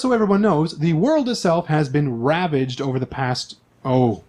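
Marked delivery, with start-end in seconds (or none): none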